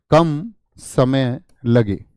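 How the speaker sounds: very slow, word by word; slow dictation. Shown as a demonstration. Teacher dictates the definition for students to write, slow dictation. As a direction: spell out each word at writing speed.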